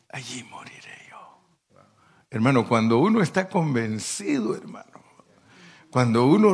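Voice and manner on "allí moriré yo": trailing into a whisper; whispered vow. Retold or imitated. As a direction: let the promise fade to a breath. Ruth's vow ending.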